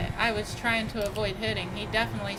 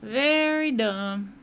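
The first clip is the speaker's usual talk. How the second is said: Very dumb drawn out slowly and loud; slow emphatic scorn; 6 dB louder, much slower than usual. Real, not acted. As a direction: lean hard and slow on Very dumb. Streamer scorning other drivers.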